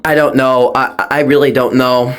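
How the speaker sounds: normal talking voice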